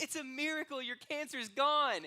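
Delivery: high, excited miracle announcement; jubilant doctor. Acted. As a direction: burst in with huge news, voice soaring to a loud finish. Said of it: "bursts through the door and goes".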